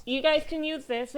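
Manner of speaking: ordinary delivery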